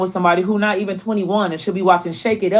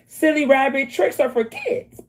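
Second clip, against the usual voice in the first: higher, slow cartoon ad voice; ad slogan; 6 semitones higher, slower than usual. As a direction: sing out the old commercial line. Quoting cereal ad.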